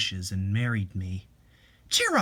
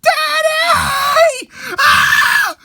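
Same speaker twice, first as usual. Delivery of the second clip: high, loud sobbing scream; screaming from a nightmare; 13 dB louder, much slower than usual. Acted. Woman jolts awake calling for her dead father in terror. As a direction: wail in terror and grief.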